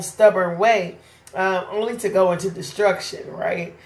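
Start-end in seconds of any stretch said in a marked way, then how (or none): none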